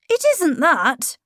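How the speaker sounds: put-on a boy insisting he's grown. A narrator's reading. Miles telling his governess he's growing up.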